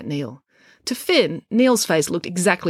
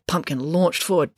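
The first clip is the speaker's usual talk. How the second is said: ordinary delivery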